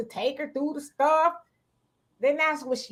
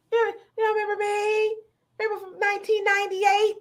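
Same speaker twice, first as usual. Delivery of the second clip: higher, eager put-on voice; hopeful ex calling; 6 semitones higher, faster than usual. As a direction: press him like a woman hoping he remembers.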